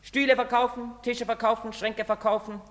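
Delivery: high, singsong shop list; mocking patter. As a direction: recite the sales talk with scorn. Son acts out salesman patter.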